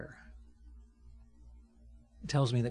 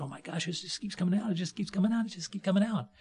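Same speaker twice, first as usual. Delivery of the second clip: higher, faster cry of astonishment; amazed disciples; 7 semitones higher, faster than usual. Retold or imitated. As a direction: keep rising in disbelief as it repeats. Amazed disciples gathering food.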